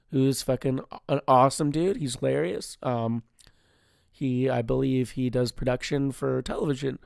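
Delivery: ordinary talk, nothing marked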